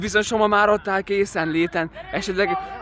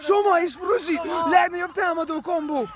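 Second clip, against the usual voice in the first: excited cheering; 7 semitones higher than usual. Real, not acted. Cheering teammates on.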